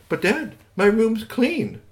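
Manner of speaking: put-on high, slow pleading boy; protesting child. Boy protesting to his father, high and slow. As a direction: plead your case to dad.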